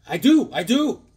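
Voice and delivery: high, loud, eager insistence; hammed-up burst of longing; playful pleading. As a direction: insist like an overexcited kid fan. Playful pleading for a wanted comic.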